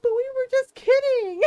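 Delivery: put-on high, loud whiny kid excuse; mock whiny kid voice; pleading brothers. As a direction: whine it like a caught child. Scared brothers excusing themselves.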